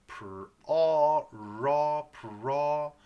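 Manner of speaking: "Pr-, ah, raw, pra" long and loud; R vowel blending. Shown as a demonstration. Teacher building up problem's first syllable, stretched. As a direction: blend R into a held AH, piece by piece. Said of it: "The R vowel".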